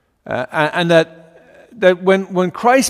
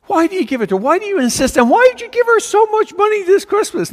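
put-on defiant child; 6 semitones higher than usual. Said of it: "I was defiant still".

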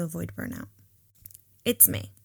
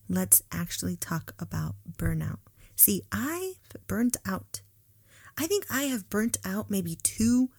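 ordinary delivery

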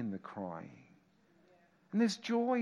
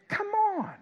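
incredulous scoff; 4 dB louder than usual. Acted. Scoffs at the world's answer.

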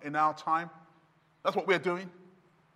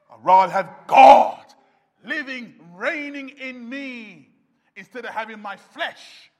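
usual speaking voice

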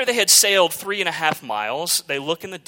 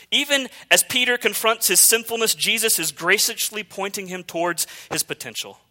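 normal talking voice

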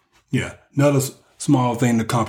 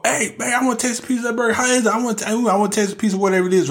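put-on high, fast wheedling girlfriend voice; mock girlfriend asking; 8 semitones higher, much faster than usual. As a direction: squeeze out a sweet quick request for fries. Voicing woman asking for burger.

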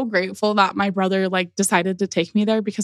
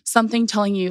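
ordinary talk, nothing marked

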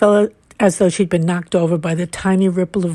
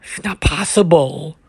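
lightly voiced old man, pitched down; disbelieving old man; 4 semitones lower than usual. A narrator's reading. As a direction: lower voice in mild disbelief. Old man disbelieving Charlotte's claim.

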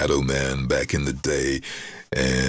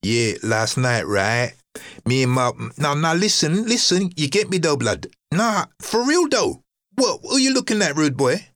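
high-pitched London street-youth character; high put-on slang voice squaring up to someone; street rude boy; 13 semitones higher than usual. Shown as a demonstration. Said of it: "you'll hear me reading a script".

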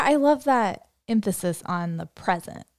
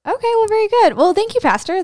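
bright sign-off; 12 semitones higher, 7 dB louder than usual. Real, not acted. Wrapping up the episode.

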